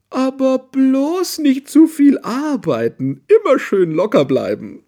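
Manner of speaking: high, loud, grinning bear voice. A narrator's reading. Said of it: "sagt Frau Bär und grinst".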